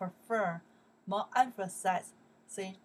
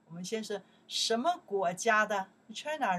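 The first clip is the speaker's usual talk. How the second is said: usual speaking voice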